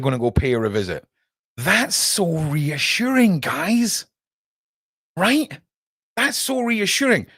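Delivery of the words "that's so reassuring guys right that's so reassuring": high, slow sarcastic drawl. Real, not acted. Sarcasm, to viewers about the government's visit. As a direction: drip with mock reassurance.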